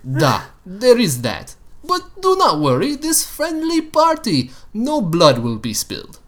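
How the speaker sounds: big friendly Russian voice; Russian accent. Acted. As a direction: reassure in a heavy Russian accent. Colossus reassures in Russian voice.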